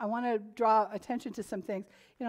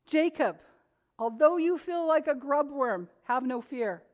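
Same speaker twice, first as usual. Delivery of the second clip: higher, raised pulpit reading; fervent sermon read; preaching scripture; 4 semitones higher, 4 dB louder than usual. Acted. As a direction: call out the verse to the congregation.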